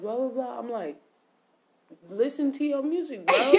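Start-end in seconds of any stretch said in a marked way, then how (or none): 2.1-3.5 high, laughing brush-off; laughing at his friend's complaint; laughing retort. Retold or imitated. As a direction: toss it off through a laugh. Retelling dismissive reply, laughing.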